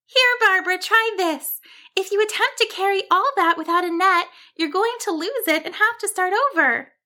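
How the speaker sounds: high-pitched, earnest little sheep voice; helpful sheep. Acted. Cassandra offers Barbara the net, helpful. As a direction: advise eagerly, pitched well up.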